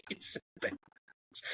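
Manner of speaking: soft unvoiced aside; whispering. Real, not acted. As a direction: whisper it to yourself. Whispered aside after laugh.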